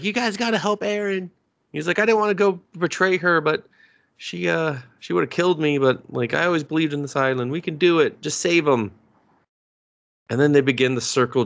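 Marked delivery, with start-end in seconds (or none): 0.0-8.9 higher, earnest mock plea; higher voice imitating a desperate soldier; pleading recruit. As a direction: beg them to save Aaron. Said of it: "He's just like".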